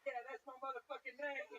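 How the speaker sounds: swaggering put-on street accent. Comic claiming his nickname in a put-on street accent. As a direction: lean into a street accent, proud and brash. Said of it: "I was back in that accent".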